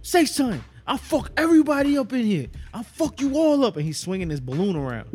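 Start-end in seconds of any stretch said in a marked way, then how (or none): 0.0-3.8 crackhead yelling. Retold or imitated. Crackhead swinging a balloon at customers, high threatening yell. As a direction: scream it like a wild aggressive crackhead.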